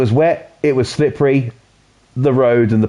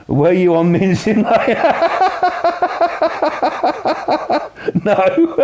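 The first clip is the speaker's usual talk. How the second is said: laughing; 10 semitones higher, slower than usual. Real, not acted. Laughing at chat joke.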